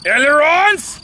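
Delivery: loud high call of the word Ailerons, half joking; ritual shout. Pretend. Pilot shouts to the filming friend before the engine start, loud playful call. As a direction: belt it out like a pilot's checklist cry. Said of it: "do you know what you must scream".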